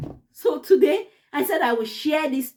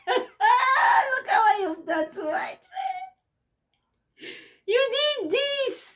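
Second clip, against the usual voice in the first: higher, slow, tearful, half laughing; mom crying; 12 semitones higher, slower than usual. Retold or imitated. Teller voicing mom showing her the burnt dress, crying. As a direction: voice mom's hurt through real tears. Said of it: "And she started crying".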